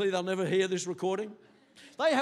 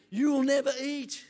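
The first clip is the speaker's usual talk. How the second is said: usual speaking voice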